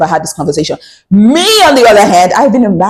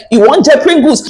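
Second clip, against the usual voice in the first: scolding mother; 6 semitones higher, 3 dB louder than usual. Retold or imitated. Mother hitting daughter, retold.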